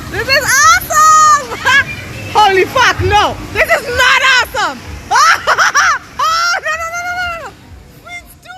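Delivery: very high, very loud terrified-thrilled shrieks; real screams riding a roller coaster; screaming on a ride. Real, not acted. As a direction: scream and laugh through the drop. Recorded live on roller coaster.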